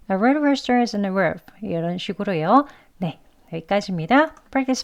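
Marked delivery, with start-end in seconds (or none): 0.1-1.4 "rude rooster" and "roof" with Rs near W; fast weak R sentence. Shown as a demonstration. Teacher showing learners the light, W-like Rs. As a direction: say it lightly with Rs sliding to W. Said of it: "르 이렇게 발음하시면 돼요".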